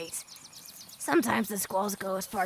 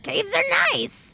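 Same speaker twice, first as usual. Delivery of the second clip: high, bright burst of cheer for caves; enthusiastic; 13 semitones higher, 5 dB louder, slower than usual. Acted. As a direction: sell caves like a treat, high and loud. Cheerful reply about sleeping in caves.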